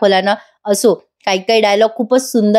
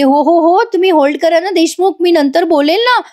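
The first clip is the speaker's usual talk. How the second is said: higher-pitched acted "hold on"; flustered interruption; 7 semitones higher than usual. Wife stopping husband's barrage of questions, flustered and high. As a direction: cut in to stop the questioner, pitched up.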